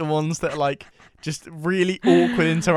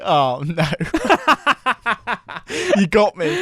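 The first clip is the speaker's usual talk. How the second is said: laughing; 5 semitones higher, 4 dB louder than usual. Retold or imitated. Caught out, laughing.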